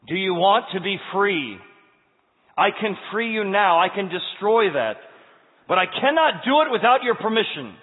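powerful angel calling out; commanding angel. Acted. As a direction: proclaim like a mighty angel. Angel speaks with authority.

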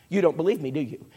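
ordinary talk

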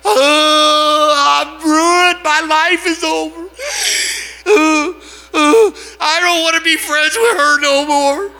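loud, high, hammed-up wailing; crying. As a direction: bawl it out like your life is over. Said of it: "so I'm crying".

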